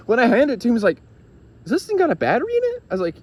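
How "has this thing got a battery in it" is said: high, quick, astonished asking; surprised question. Retold or imitated. Friend amazed at how light the device is, high surprised question. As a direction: squeak it out in disbelief.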